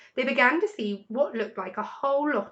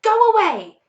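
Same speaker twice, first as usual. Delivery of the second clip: high shrill shriek, loud; 12 semitones higher, 11 dB louder than usual. A narrator's reading. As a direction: screech at them to get out. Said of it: "shrieked Rat".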